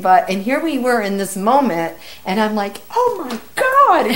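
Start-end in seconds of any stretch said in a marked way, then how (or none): 2.9-4.1 high, drawn-out exclamation; slow, high oh-my-God of disbelief; freaking out. Retold or imitated. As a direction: relive the moment of freaking out. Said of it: "me and him are freaking out".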